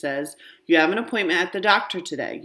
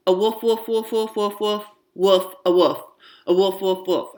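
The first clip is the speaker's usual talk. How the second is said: playful dog woofs; 2 semitones higher than usual. A narrator's reading. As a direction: bark like a child playing dog. Child barks as a dog.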